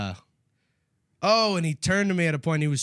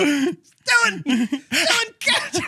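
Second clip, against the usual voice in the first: high, loud playful call across the room; playful yelled call to a friend; 13 semitones higher, 5 dB louder, much slower than usual. Retold or imitated. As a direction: shout his name up high, then toss. Calling out to friend to catch.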